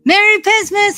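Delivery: high, loud cheerful shout; squealed Merry Christmas; excited greeting. Real, not acted. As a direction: burst out with a big holiday hello. Greeting a viewer loudly on stream.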